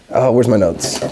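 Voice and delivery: usual speaking voice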